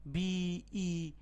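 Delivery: বিই stretched very long, as a caller drawls a letter; বিই held long like a spelled code letter; drawn-out letter. Shown as a demonstration. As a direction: drag out বিই slowly before a pause. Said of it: "মাঝখানে হচ্ছে গাড়ির number বললো".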